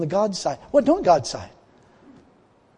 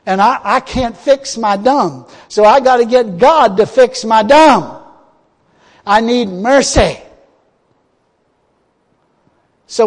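full-force preaching; 3 semitones higher, 8 dB louder than usual. Acted. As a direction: preach it out to the congregation.

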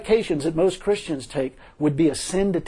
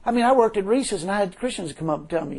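ordinary delivery

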